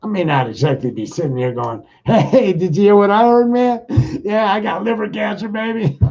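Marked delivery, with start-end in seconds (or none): 2.0-6.0 high, loud, hammed-up jolly news; mock cheerful. Mock-happy bragging to a friend about liver cancer, hammed-up cheerful. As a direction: sell it like great news, laughing.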